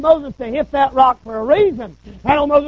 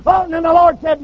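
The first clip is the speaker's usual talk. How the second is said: usual speaking voice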